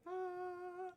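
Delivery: a high, thin startled yelp; a tiny high startled ah. Real, not acted. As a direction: yelp as if falling. Shrinking the camera.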